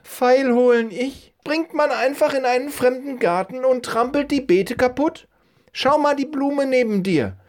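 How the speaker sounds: high-pitched indignant falsetto lady; scolding old woman. Acted. Old woman blames boy for snapped flower, scolding in high falsetto.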